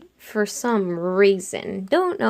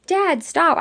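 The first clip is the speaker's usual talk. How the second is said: high, contained mock yell; sharply pitched-up retold cry; 8 semitones higher than usual. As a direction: call out as if yelling, without full volume. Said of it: "I literally yell it".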